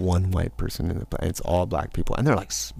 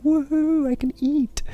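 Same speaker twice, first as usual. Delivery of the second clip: much higher, gleeful cheer; gleeful woohoo. Retold or imitated. Finding ten dollars left over, high gleeful cheer. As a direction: celebrate like a kid.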